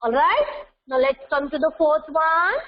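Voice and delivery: usual speaking voice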